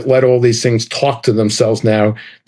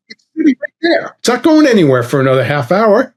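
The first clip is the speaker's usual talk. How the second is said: higher, incredulous pushback at the gate; pitched-up disbelief at a pointless rule; retold indignant protest; 8 semitones higher than usual. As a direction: argue it like it's obvious, voice jumping up. Retold argument with gate attendant.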